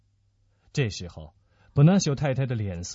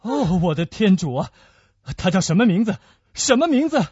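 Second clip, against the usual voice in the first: urgent, rising, raised voice; agitated demand, climbing higher; alarmed questioning; 6 semitones higher, 4 dB louder than usual. Acted. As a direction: press anxiously for the name. Alarmed questioning about the unknown woman.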